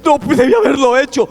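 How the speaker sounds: high, pleading imitation of remorse; lightly acted weeping plea for forgiveness; mock tearful confession. Preacher voices unfaithful husband begging God's forgiveness, mock tearful. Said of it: "al hombre que es infiel llorando".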